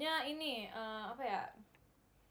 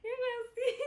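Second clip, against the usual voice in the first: high-pitched helpless giggling; 11 semitones higher, 4 dB louder than usual. Real, not acted. Giggling at the name mie kuskus.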